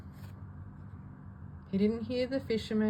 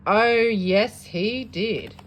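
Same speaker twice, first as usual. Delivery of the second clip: shouted oh yes; 7 dB louder, much slower than usual. Acted. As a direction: fire it back, sure of it. Classmates argue oh no oh yes.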